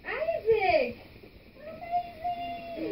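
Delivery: excited praise. Real, not acted. Teacher praising a student who spelled Mexico, excited loud cheer. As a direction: cheer the student on for getting it right.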